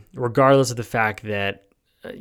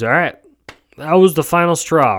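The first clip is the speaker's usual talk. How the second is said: louder, higher mock declaration; mocking outrage; 5 semitones higher, 7 dB louder than usual. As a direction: parody a fed-up crowd. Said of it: "the first thing that everyone goes towards is".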